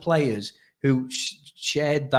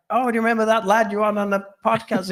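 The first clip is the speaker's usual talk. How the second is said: lightly imitated strong Irish accent, higher; quoting the lad in a strong Irish accent; 6 semitones higher than usual. Telling co-host how the Dublin lad greeted him, in an Irish accent. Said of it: "this really strong Irish accent".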